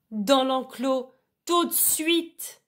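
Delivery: slow, high-pitched yelled command; yelling over din. A narrator's reading. Sheepdog commanding sheep over tank din, drawn-out high yell. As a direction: stretch the order out over a racket. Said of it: "hurla-t-il pour couvrir le vacarme".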